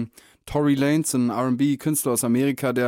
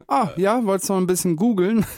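higher-pitched mock Oma voice; imitated grandma; 7 semitones higher than usual. Grandchild quoting Oma on internet things, imitated grandma voice. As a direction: play a clueless, well-meaning Oma asking. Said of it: "dann hat sie so gesagt".

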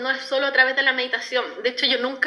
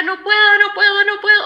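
high, loud, desperate repeated "No puedo"; retold crying plea; 9 semitones higher, 8 dB louder than usual. Reliving her meditation, she cries out she can't, high and loud. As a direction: cry out "No puedo" over and over, pitched up. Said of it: "y yo lloraba, lloraba".

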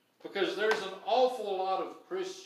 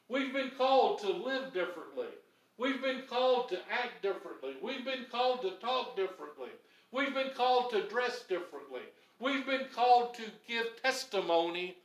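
higher, stressing CALLED each time; building repetition; 5 semitones higher than usual. Real, not acted. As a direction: hammer the word called again and again. Preacher's rhythmic repetition.

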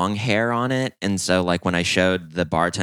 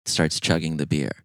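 ordinary talk, nothing marked

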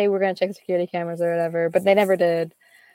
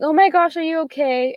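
put-on worried girlfriends; 8 semitones higher than usual. Girlfriends texting after the accident, higher gushing concern. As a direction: gush with concern like a teen girl. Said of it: "All my girlfriends were like".

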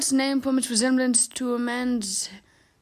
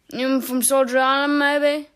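put-on higher, louder Devon accent; 5 semitones higher, 7 dB louder than usual. A narrator's reading. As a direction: ask it in a lilting Devon voice. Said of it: "he asked in a soft Devon voice".

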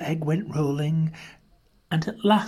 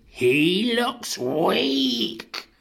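very slow, high-pitched moan; grumbling mother dinosaur; 6 semitones higher, much slower than usual.

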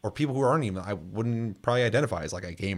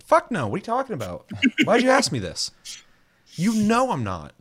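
incredulous refusal; 9 semitones higher than usual. Retold or imitated. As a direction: scoff at the silly question.

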